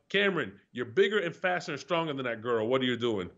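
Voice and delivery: ordinary talk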